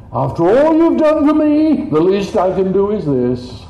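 sudden high loud overwrought cry; mock fervent gratitude. The guilty believer promising to repay God, hammed-up and loud. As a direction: ham up grovelling indebtedness.

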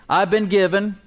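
emphatic phrases with long pauses for echoing; proclaimed confession of faith, deliberately phrased; leading group declaration. Shown as a demonstration. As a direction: lead the congregation line by line. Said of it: "Say this with me".

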